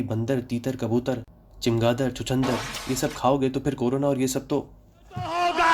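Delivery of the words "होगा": sudden squeaky high punchline word; comic squeal. Acted. Mocking people who eat bats, in a sudden high squeal. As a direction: squeal the last word like a cartoon. Mocking Chinese eating habits.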